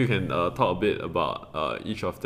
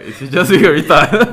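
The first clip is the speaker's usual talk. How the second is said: laughing; 8 semitones higher, 14 dB louder than usual. Real, not acted. Laughing at the viral guy.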